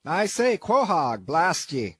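put-on brash old skipper, loud and blunt; gruff old captain. Peleg asking Queequeg how he signs, loud and blunt. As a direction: bark at the recruit like a salty captain.

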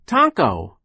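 high, loud little outburst; shriek. A narrator's reading. Little girl protests the gum, shrieking. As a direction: pipe it up sharply as the youngest child. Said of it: "Sunny shrieked".

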